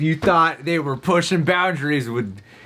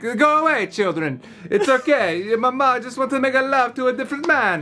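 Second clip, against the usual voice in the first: hammed-up Italian accent, higher; mock Italian mama; 9 semitones higher than usual. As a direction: wave the kids off in a comic Italian accent. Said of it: "'Cause you're Italian now".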